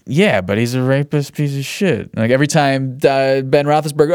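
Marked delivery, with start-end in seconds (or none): 0.1-2.1 smug. Retold or imitated. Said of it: "I always smugly sat back and was just like".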